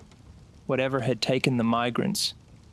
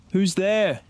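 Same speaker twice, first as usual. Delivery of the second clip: higher, louder call into the dark; 7 semitones higher, 4 dB louder than usual. Acted. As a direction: call out sharply to the trees. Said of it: "she called, her voice cutting through the silence".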